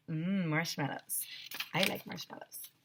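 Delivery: soft, dreamy craving murmur. Real, not acted. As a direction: savour the word marshmallows. Reader's own dreamy aside.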